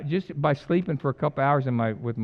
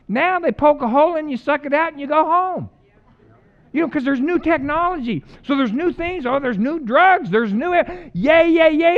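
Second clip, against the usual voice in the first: higher, louder mock cheer over new cures; mock excitement; 10 semitones higher, 5 dB louder than usual. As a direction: hype up the new drugs sarcastically. Mocking modern medicine, excited.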